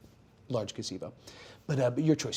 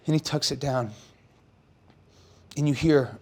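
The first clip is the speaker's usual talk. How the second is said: usual speaking voice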